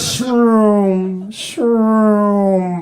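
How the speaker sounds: loud, drawn-out engine whoosh; imitated race car zooming by; psychedelic race car. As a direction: roar past twice, each "shroom" sliding down. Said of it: "sound of a psychedelic race car".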